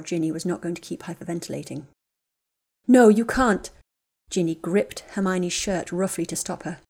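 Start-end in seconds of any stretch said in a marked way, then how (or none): 2.9-3.7 loud, urgent cry; frantic plea to stay; desperate protest. Acted. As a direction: stop her from going, panicked. Ginny stops Hermione leaving.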